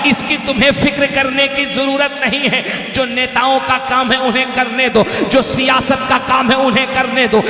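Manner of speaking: loud, driving oratory in parallel phrases; full-force preaching. Acted. Preacher urging Muslims to live for the Prophet, impassioned loud exhortation. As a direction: exhort the crowd, building to a high shouted climax.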